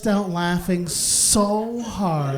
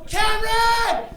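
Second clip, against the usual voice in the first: excited shout; 14 semitones higher, slower than usual. Retold or imitated. Comic calling his boss in the meeting, drawn-out shout. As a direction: call his name across the room. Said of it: "I just go".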